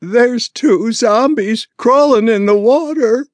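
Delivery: put-on high, loud excited child's voice; a wide-eyed kid blurting the news; boy tells his father about zombies, high and loud; scared little boy. A narrator's reading. Frightened boy reports to parents.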